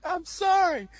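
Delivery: high-pitched imitation of begging; hammed-up sorry; mock pleading apology. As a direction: play him whimpering for forgiveness. Retold begging apology to dad.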